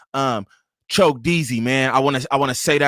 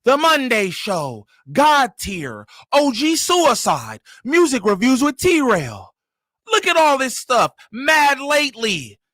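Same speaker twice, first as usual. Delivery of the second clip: high, drawn-out announcer calls; show announcer hype; 8 semitones higher, slower than usual. Acted. Host rolling out the show's line-up, hyped announcer. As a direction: call out each segment slowly and high-pitched.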